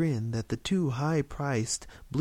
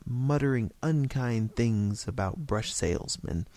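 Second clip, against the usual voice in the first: ordinary delivery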